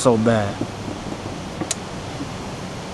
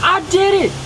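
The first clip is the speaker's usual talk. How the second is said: high, proud taunting voice; high mock voice. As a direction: say it high and smug. Voicing himself in story.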